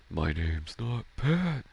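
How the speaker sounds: slow, high cartoon voice; Patrick voice. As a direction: imitate a cartoon starfish, drawing it out. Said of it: "try to go to the Patrick voice".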